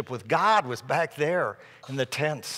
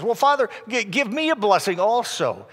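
higher-pitched indignant plea; acted upset son, voice raised in pitch; furious; 8 semitones higher, 4 dB louder than usual. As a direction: demand it in mock outrage. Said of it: "Esau is furious".

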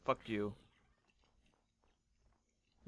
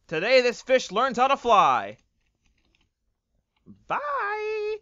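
higher, louder grand declaration; hammed-up heroic cry; 10 semitones higher, 10 dB louder than usual. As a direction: announce it like an epic moment. Dramatic escape declaration.

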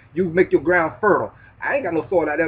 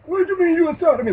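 much higher, incredulous objector voice; high-pitched scoffing questioner; mock doubter; 14 semitones higher, 4 dB louder than usual. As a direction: play the skeptic who can't believe it. Voicing an imagined doubter.

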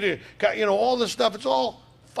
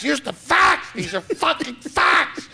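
high, loud mock yell, slowing at the end; exasperated mock shout, laughing; mock shouted facts; 8 semitones higher, 4 dB louder, much slower than usual. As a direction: hammer the facts at them. Acting out giving people facts.

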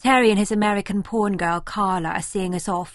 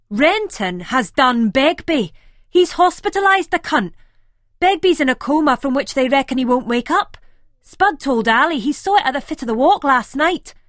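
agitated telling; 6 semitones higher, 8 dB louder than usual. A narrator's reading. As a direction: relay the news still reeling. Simon relays shocking news.

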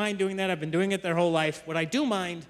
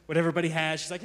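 normal talking voice

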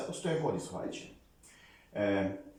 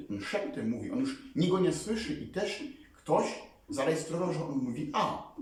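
normal talking voice

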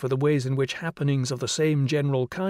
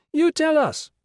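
high, loud shrill call; shrill child call; 6 dB louder than usual. Acted. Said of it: "called a shrill voice".

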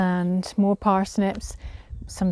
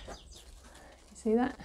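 whisper; 3 semitones higher, 12 dB quieter, much slower than usual. Real, not acted. Whispered aside while showing cucumber.

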